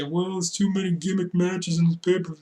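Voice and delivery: mocking the complaints; mock complainer. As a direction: put on a lightly whiny critic's voice. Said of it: "people are gonna say".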